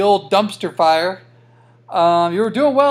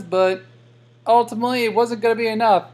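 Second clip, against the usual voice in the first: ordinary talk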